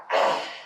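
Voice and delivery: long noisy demonstrated exhale; bear breath out. Shown as a demonstration. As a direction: push all the breath out audibly. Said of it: "let your bear breath out".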